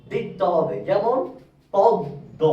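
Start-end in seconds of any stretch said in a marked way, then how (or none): 1.7-2.4 পদ্ম split as pod-do; doubled দ. Shown as a demonstration. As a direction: break পদ্ম on the double d, m silent. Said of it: "দ এর উচ্চারণ দুবার হচ্ছে".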